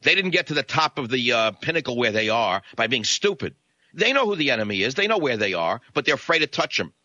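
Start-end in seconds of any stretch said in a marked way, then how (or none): none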